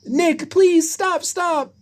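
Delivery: very high, drawn-out plea; urgent pleading. Acted. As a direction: beg slowly in a very high voice. Judy begging Nick to stop.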